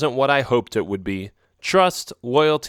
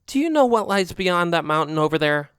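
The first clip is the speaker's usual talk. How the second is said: a knowing, warning alley cat; lightly voiced friend cat; 6 semitones higher than usual. Friend cat Oreo warning him off the rocks, lightly voiced. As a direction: warn him off, lightly raised voice.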